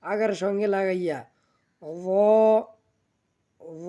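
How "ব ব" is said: "ব" with tongue tip on the edge of the top front teeth; teeth-edge sound. Shown as a demonstration. Teacher showing learners an Arabic letter, "ব" tongue against teeth. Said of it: "সামনের উপরে দুই দাঁতের".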